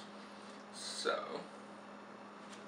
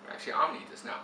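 usual speaking voice

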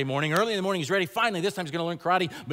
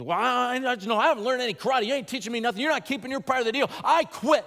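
angry quitting; 6 semitones higher than usual. Retold or imitated. Daniel quits in anger.

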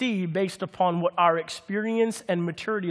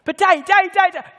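put-on much higher and louder, whiny toddler; pleading little girl voice; 11 semitones higher, 7 dB louder, much faster than usual. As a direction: beg Daddy like a small child. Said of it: "She's like".

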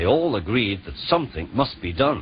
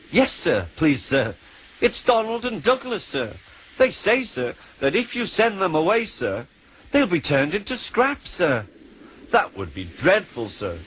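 nervous pleading; 8 semitones higher than usual. Acted.

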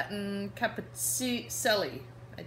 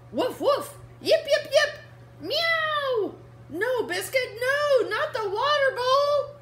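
much higher, louder mock alarm; 9 dB louder, slower than usual. Reading to kids, the girl pleads with Biscuit about the bowl. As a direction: shriek no as the bowl tips.

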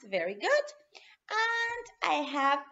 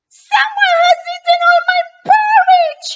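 much higher, louder little bear voice; Baby Bear; 15 dB louder than usual. Acted. Baby Bear cries to his parents, high voice. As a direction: squeak it as the tiny bear. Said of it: "Baby Bear says".

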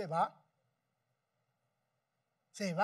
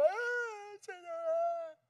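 a high mock lament; imitating her wail; 8 semitones higher, 3 dB quieter, much slower than usual. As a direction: wail it softly like the mother. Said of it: "la encontraba". (lamento imitado de la madre, agudo)

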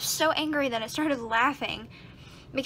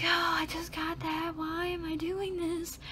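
slow, higher, whiny complaint; exasperated whine; 5 semitones higher, slower than usual. Retold or imitated. Groaning about the broken cord she just bought, slow and whiny. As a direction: moan it like it's so unfair. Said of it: "and I'm like".

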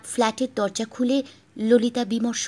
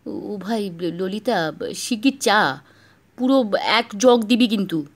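plaintive; slower than usual. Acted. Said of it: "করুণ সুরে বললেন".

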